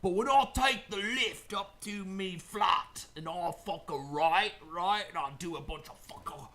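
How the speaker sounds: hammed-up bad Cockney toast; Cockney accent. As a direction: ramble like a rowdy Cockney mate. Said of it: "I'll do it in Cockney accent".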